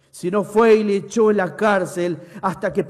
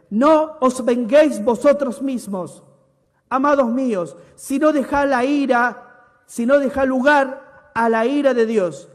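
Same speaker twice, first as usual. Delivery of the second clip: a preacher thundering the verse; preaching; 2 semitones higher, 5 dB louder than usual. Acted. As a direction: proclaim it to the congregation. Preached scripture reading.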